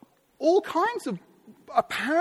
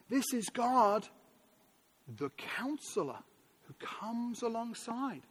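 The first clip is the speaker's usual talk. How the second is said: slow emphatic; 7 dB quieter, slower than usual. Real, not acted. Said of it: "preacher slowing for emphasis".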